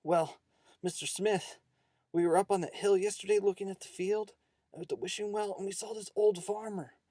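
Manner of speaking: high-pitched, excited schoolboy voice; boy shouting out. A narrator's reading. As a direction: blurt it out high to the teacher. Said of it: "One of the boys shouted out".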